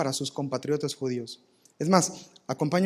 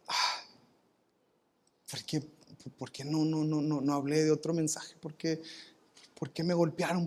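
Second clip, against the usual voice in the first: soft, anguished, much softer; anguished self-questioning; 7 dB quieter than usual. Pretend. Paul alone in prison questioning himself, quiet anguish. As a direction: ask yourself why in quiet despair. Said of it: "se pudo haber dicho a sí mismo".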